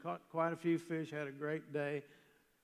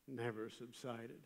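ordinary delivery

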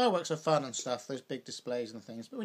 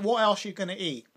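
emphatic, louder; 6 semitones higher, 8 dB louder than usual. Real, not acted. Emphatic rhetorical question.

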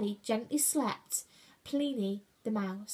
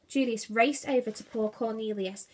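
ordinary delivery

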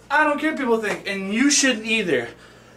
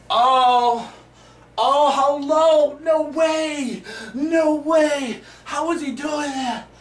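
higher, slow pretend astonishment; mock amazed onlooker; 5 semitones higher, much slower than usual. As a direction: play a stunned spectator. Plays amazed onlooker at his own trick.

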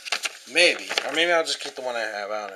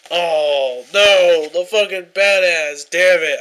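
loud, higher angry cursing; real furious outburst; 4 semitones higher, 10 dB louder, much slower than usual. Real, not acted. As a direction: let it rip as the page tears. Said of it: "now I'm fucking pissed off".

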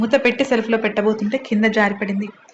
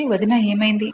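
ordinary delivery, nothing marked

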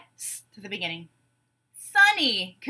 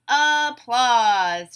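"Applause" stretched in two, a-plause; loud, drawn-out beats on "Applause"; syllable by syllable; 6 dB louder than usual. Shown as a demonstration. Teacher breaking a word into syllables, beat by beat. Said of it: "we'll break it into syllables".

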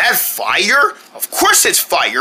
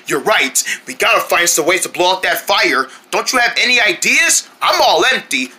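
normal talking voice

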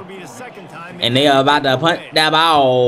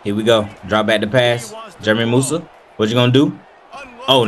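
ordinary talk, nothing marked

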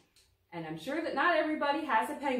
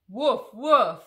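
loud playful puppy woofs; puppy barks; 13 dB louder than usual. Acted. Being Biscuit the puppy for the kids, loud playful woofs. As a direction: bark like a happy little dog.

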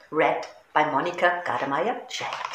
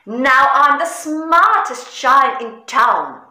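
higher, louder, puffed-up boast; proud; 7 semitones higher, 5 dB louder than usual. A narrator's reading. Giant showing off new clothes, proudly. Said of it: "he said proudly".

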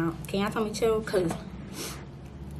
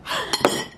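sharp gasp. Real, not acted. Nearly drops the cake.

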